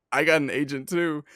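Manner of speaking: mock boastful agent line; overeager boast. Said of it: "I didn't say it just like that".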